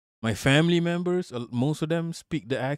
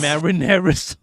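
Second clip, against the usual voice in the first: louder mock Chicago "Marinara sauce", flat open a's; imitating Chicago accent; 5 dB louder than usual. Streamer teasing his chat about the Chicago accent, louder mock accent. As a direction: belt out "Marinara sauce" like the Chicago sample lady. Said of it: "Is that how you talk".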